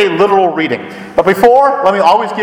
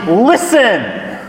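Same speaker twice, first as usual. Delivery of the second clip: "Listen!" called out a little higher, as a mild exclamation; exclaiming listen; 5 semitones higher than usual. Retold or imitated. Woman calls to hear her beloved, mild exclamation. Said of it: "The woman exclaims".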